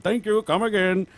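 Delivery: put-on high, loud Indian shopkeeper accent; Apu impression. Friends mocking a cartoon clerk, in a put-on Indian accent. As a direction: chirp it like a cheerful Indian clerk.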